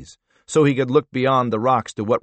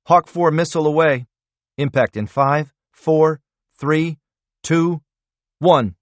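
tense countdown; 5 semitones higher, 5 dB louder than usual. A narrator's reading. Radio countdown.

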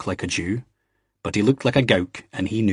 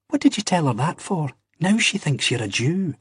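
higher, reproachful Ian voice; 8 semitones higher than usual. A narrator's reading. Ian chiding Jamie over the barmaid, higher. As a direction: scold him as Ian, voice raised in pitch.

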